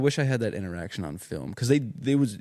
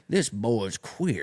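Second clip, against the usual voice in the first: slow Southern drawl; a mock Alabama drawl, slow; 2 semitones higher, much slower than usual. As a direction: sneer like a rural clerk. Mocking redneck clerk.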